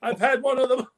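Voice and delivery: high, cackling mock laugh running through the words; a high, hammed-up cackle that breaks up the line; imitating Todd's laugh. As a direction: ham up a friend's wild, high laugh. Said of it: "and this is him".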